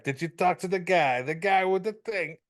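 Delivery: hammed-up wiseguy act; Billy Crystal playing mobster. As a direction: imitate a comic pretending to be mob. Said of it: "Billy Crystal's pretending to be, like, the mob consigliere".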